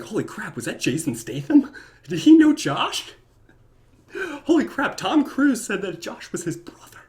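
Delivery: high, loud astonished mourner voice; shocked gasp. Pretend. Funeral guests stunned by the celebrity eulogy, high shocked squeal. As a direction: squeal it out in disbelief.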